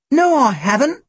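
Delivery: high-pitched protest. A narrator's reading. Tim denying to the guard that he has a gun, protesting. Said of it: "Tim protested".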